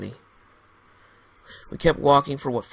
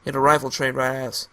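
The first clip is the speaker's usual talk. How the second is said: usual speaking voice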